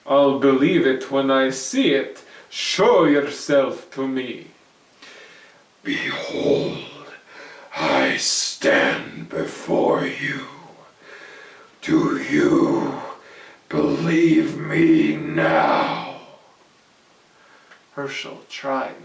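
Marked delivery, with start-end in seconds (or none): 0.1-4.5 put-on louder, brash young man brushing it off; bold Herschel, scoffing. Herschel voiced by narrator. 5.8-16.2 much lower, loud and very slow; menacing goblin king. Acted. Goblin king reveals himself.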